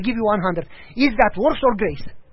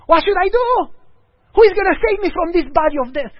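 desperate plea; 8 semitones higher, 4 dB louder than usual. Acted. Desperate believer pleading with God.

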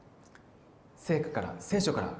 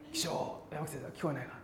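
quiet listening; 9 dB quieter than usual. Pretend. Pretending to listen for the call.

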